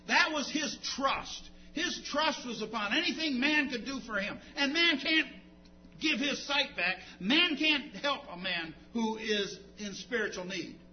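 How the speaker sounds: loud, declaiming pulpit voice; forceful sermon proclamation; impassioned preaching. Real, not acted. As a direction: preach it at full force, punching key words.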